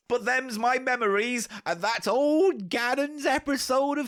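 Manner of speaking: put-on high, slow West Country accent. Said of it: "your West Country voice".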